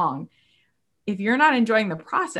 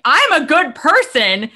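much higher, louder, slow mocking of a smug claim; mock self-righteous; 8 semitones higher, 9 dB louder, slower than usual. Imitating people who boast of their virtue, mocking high smug voice. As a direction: parody someone proud of their goodness. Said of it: "their face at, as they're saying".

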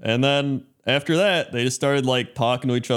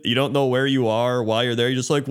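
normal talking voice

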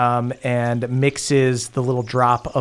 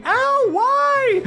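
very high, squeaky yelp of pain; a cartoon sidekick getting tackled; Denny's voice. Denny gets tackled to the ground, very high squeaky yelp. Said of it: "hard one tackles Denny".

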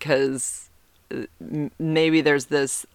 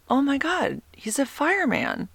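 giddy realization; 11 semitones higher than usual. Retold or imitated. Excited realization retold.

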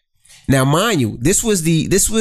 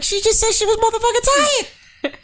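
mocking high voice. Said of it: "I'm like".

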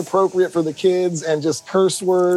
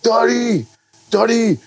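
put-on higher, hollered name like a drunk; drunk fan; 7 semitones higher than usual. Drunk guy backstage hailing the singer, rowdy imitation. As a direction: imitate a fired-up drunk calling out. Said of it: "He's all drunk".

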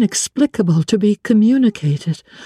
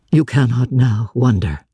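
lightly lowered, brooding man's voice; a blind master confiding his anguish; a hint of a grave older man; Rochester's voice; 7 semitones lower than usual. Rochester resumes.

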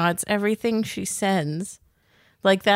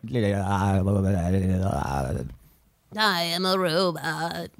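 low, slow, emotionless robot drone; flat machine voice, drawn out; old lady robot; 14 semitones lower, slower than usual. Pretend. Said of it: "Old lady robot".

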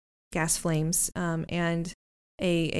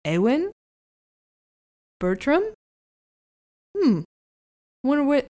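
muffled voice behind a door; muffled through door; 3 semitones higher than usual. Acted. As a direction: speak as if through a closed door. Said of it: "Rose's muffled voice".

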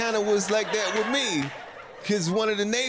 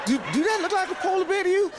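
much higher, alarmed pitch; high, alarmed question; 13 semitones higher, 3 dB louder, faster than usual. Retold or imitated. Tourist asks friend about the bear, high and alarmed. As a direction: ask it high and anxious, eyes wide.

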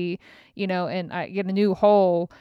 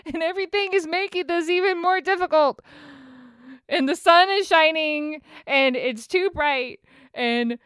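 exasperated whine; 8 semitones higher than usual. Real, not acted. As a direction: whine it way up like it's all too much. Venting frustrations, high whiny voice.

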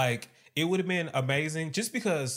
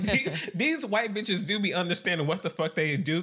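ordinary delivery

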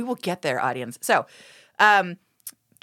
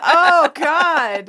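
shocked groan; 6 semitones higher, 6 dB louder than usual. Real, not acted. Shocked reaction continues.

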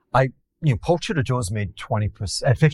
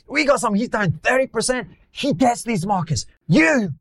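high-pitched mock Italian accent; 13 semitones higher than usual. Italian billionaires berating their down-three-percent fund manager, high Italian accent. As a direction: play an excitable Italian investor, accent and pitch pushed up. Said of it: "I'm putting on an Italian accent".

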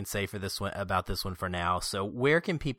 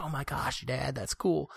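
lightly voiced son, higher and softer; a hint of an impressed kid, pitched up; imitated son; 5 semitones higher, 5 dB quieter than usual. Dad quoting his son's reply at the movie, lightly imitated kid. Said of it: "And he's like".

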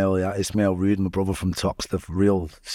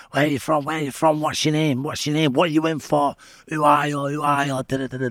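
higher, overlapping mock shouts from cells; retold shouting; 7 semitones higher than usual. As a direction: pepper him with questions, higher. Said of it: "everyone shouts stuff at you".

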